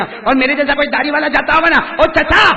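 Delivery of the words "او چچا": calling out. Retold or imitated. People calling bearded man.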